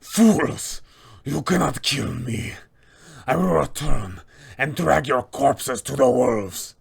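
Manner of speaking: defiant vampire cursing as he flees; Strahd. Acted. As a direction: spit out a booming villain's threat. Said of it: "he will expout".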